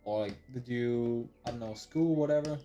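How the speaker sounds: ordinary talk, nothing marked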